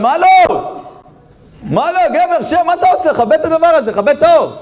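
high, loud shouting at a nuisance; angry crowd yelling. Acted. Moviegoers yelling at the villager with the flashlight. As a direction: bark at him to sit down.

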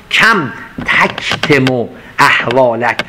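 very slow, lingering verse; slow poem recitation. Acted. Teacher chanting Rumi to listeners, very slow. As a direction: let the verse ring out slowly.

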